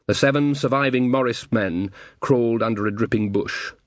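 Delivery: usual speaking voice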